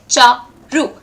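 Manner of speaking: CHA-RU slowly split into two beats; broken into syllables. Shown as a demonstration. Teacher counts syllables in her own name, Cha - ru split apart. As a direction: say Charu as Cha, pause, ru. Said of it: "Two syllables".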